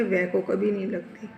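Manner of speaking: normal talking voice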